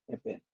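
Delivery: "event" with stress on the second syllable; light start on "event", weight on its last syllable. Shown as a demonstration. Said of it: "stress is on the second syllable".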